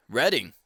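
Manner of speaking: "Reading" pronounced RED-ing, falling; short e in "Reading", like RED-ing; RED-ing pronunciation. Shown as a demonstration. Reporting the looked-up answer, "Reading" as RED-ing. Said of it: "it's pronounced".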